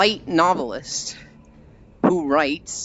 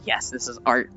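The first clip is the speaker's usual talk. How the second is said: low, soft mock self-satisfaction; understated smugness, dropped in pitch; smug; 4 semitones lower, 5 dB quieter than usual. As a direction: imitate a quietly smug artist. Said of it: "looking really smug".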